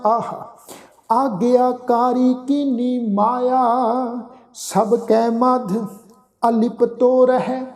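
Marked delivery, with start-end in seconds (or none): 1.1-7.6 slow, drawn-out sacred recitation; measured Gurbani reading, sustained line endings; scripture recitation. Shown as a demonstration. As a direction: chant each phrase slowly with long held vowels.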